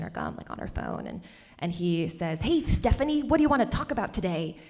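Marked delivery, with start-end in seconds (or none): 2.4-4.6 higher, annoyingly jolly lisped greeting; jolly lisping man. Retold or imitated. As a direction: imitate a chirpy customer with a lisp. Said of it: "talked with a lisp, and he was, like, annoyingly jolly".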